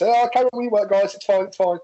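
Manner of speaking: put-on high and cheerful to kids; breezy teacher tone; bright reassuring.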